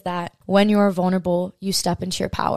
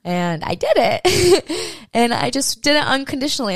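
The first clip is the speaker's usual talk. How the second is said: laughing; 6 semitones higher than usual. Real, not acted. Laughing at own boldness.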